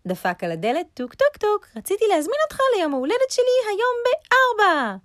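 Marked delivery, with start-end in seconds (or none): 0.9-1.7 high, loud knock-knock sound; knocking noise. A narrator's reading. Rabbit knocks on hare's door, high vocal knocks. 1.8-5.0 fast, loud, high rabbit voice; eager rabbit. A narrator's reading. Rabbit invites friend to his birthday, fast and high.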